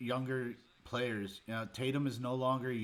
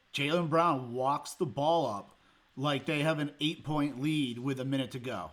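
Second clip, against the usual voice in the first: normal talking voice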